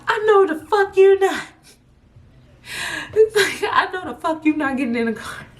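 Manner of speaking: be the furious big brother; high laughing mock yell; outraged brother.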